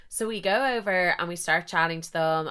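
usual speaking voice

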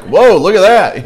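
casual wide-eyed wonder; amazed whoa. Retold or imitated. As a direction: gape at the burning bush. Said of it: "I would have just been like".

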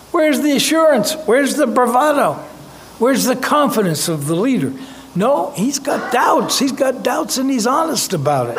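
incredulous exclamation. Real, not acted. Preacher exclaims at doubting leader.

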